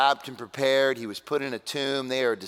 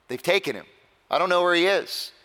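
mildly exasperated, raised insistent reply; exasperated Mary; 6 semitones higher, 4 dB louder, faster than usual. Acted. Weeping woman answers the angels about her missing Lord, exasperated. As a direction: answer as a distraught woman, voice lifting.

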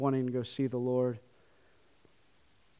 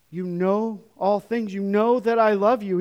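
pleading; 7 semitones higher, 7 dB louder, slower than usual. Acted. Peter's pleading appeal.

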